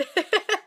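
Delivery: short high laugh; a quick laugh at herself. Real, not acted. Laughs after joke.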